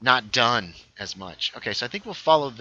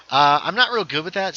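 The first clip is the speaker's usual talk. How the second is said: ordinary talk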